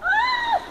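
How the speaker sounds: high scream as the icy water hits; cold shock scream. Real, not acted. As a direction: shriek on plunging into freezing water. Plunges into icy river.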